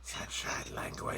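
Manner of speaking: breathy slow whisper. Real, not acted. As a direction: murmur it slowly under your breath. Table joke, whispered aside.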